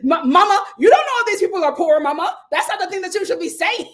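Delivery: loud, high imitation breaking into laughter; hammed-up reproach, tickled by it; amused mock scolding. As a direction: scold Mama while cracking up.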